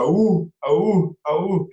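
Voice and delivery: high, loud, slow "awoo" howls; howling like a wolf pup. Shown as a demonstration. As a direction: lead the crowd in a howl. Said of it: "so we can all go".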